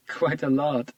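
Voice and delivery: ordinary delivery, nothing marked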